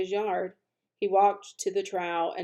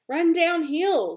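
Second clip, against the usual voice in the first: cow voice; 9 semitones higher, 6 dB louder, slower than usual. Acted. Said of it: "suggested the cows".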